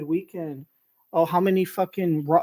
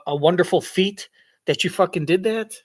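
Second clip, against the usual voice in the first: normal talking voice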